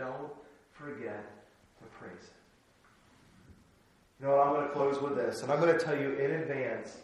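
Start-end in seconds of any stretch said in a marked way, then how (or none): 0.0-2.4 much softer and lower, gently trailing; hushed gentle repeat; soft reminder. Real, not acted. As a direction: close the thought tenderly to the hearers. Quiet repeat of key line.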